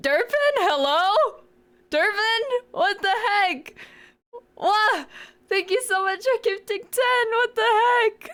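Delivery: high, squealing surprise at a gift; excited squeal. Real, not acted. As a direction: squeal with shocked delight. Excited reaction to gifted subs.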